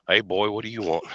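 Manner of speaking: imitated deep Texas accent; comedian's Texas twang, imitated. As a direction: put on a thick Southern, Texan accent. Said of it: "that deep-ass Texas accent".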